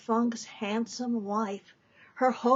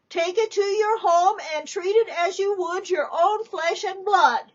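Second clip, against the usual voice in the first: loud and much higher; nun announcing; 8 semitones higher, 6 dB louder than usual. Acted. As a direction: address the whole depot.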